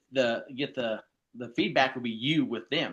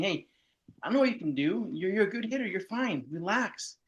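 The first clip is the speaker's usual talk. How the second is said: higher, bright pep-talk voice, easing the pressure; upbeat coach encouragement; 9 semitones higher than usual. Retold or imitated. Coach telling a pressured batter to relax, in a high encouraging voice. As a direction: play the reassuring coach calming a nervous batter.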